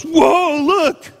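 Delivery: high, loud startled cry at a cliff edge; a hammed-up gasp of fright; mock fearful cry. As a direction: yelp like you're about to fall. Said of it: "No one walks up and goes".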